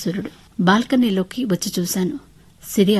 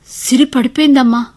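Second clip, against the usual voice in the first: panicky, louder and pitched up; flustered; 5 semitones higher, 8 dB louder than usual. Acted. Mother telling her parents the girl fell, flustered. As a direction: blurt it out in a fluster. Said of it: "అన్నాను కంగారుగా".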